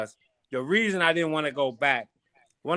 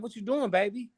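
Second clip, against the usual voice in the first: lightly imitating an auntie, higher and softer; auntie's high voice; 8 semitones higher, 5 dB quieter than usual. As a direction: mimic big auntie sweetly, pitch up. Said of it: "She, she got a real high-pitched voice".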